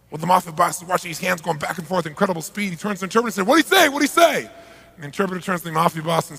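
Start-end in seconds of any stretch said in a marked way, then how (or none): 3.4-4.5 much higher, loud eager demand; frantic demand. Retold or imitated. Mafia boss frantically demanding the interpreter's translation, high and loud. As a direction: bark it, desperate for the answer.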